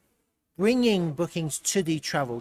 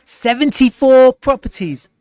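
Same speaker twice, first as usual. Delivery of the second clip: loud, high-pitched burst of real amazement; astonished outburst; 9 semitones higher, 11 dB louder than usual. Real, not acted. Streamer discovers a huge batch of new listings, astonished. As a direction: blurt it out, stunned and thrilled.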